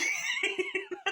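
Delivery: short high laugh; high burst of laughter. Real, not acted. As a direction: laugh at your own joke. Laughing at her own impression.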